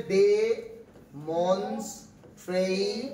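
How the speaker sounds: ডেমনস্ট্রে- drawn out very slowly as de-mons-tray; syllable-by-syllable pronunciation. Shown as a demonstration. Teacher demonstrates pronunciation to class, ডেমনস্ট্রে- in slow syllables. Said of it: "উচ্চারণ হচ্ছে".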